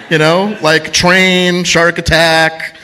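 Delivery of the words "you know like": ordinary delivery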